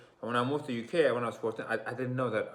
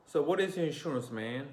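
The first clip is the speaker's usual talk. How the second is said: higher, fuller American accent; 5 semitones higher than usual. Shown as a demonstration. Showing viewers how Americans ask, in an American accent. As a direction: say it the American way, R sounded. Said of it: "that's how we speak in America".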